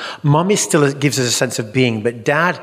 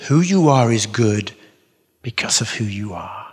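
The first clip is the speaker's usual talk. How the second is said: tender mum; 3 semitones lower than usual. Retold or imitated. Mum strokes and comforts child.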